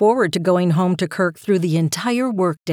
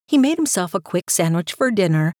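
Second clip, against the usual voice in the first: ordinary delivery, nothing marked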